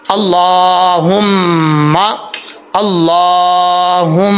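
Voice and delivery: slow अल्लाहुम्मा, humming the doubled m in the nose; ghunna recitation. Shown as a demonstration. Teacher asking what this is, ghunna drawn out on अल्लाहुम्मा. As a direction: hold the mm of अल्लाहुम्मा long and nasal. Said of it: "ये गुन्ना है".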